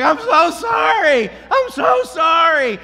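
high, loud, drawn-out call; shouted apology. Retold or imitated. Dog owner running toward the walker, shouting apologies. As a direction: holler an apology from down the street.

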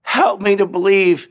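loud, high desperate plea to God; crying out. Retold or imitated. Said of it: "he cried out".

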